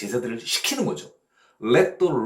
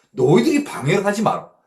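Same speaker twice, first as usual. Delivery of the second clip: raised, louder rebuke; loud, higher-pitched telling-off; Jesus rebuking disciples; 4 semitones higher, 6 dB louder than usual. Acted. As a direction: lay down the ruling firmly, voice lifted. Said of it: "예수님께서 지금 어떻게 판정을 내려주시는 거예요".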